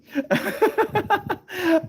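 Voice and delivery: long high-pitched laugh; real laughter. Real, not acted. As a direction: crack up at your own mishap. Laughs at own story.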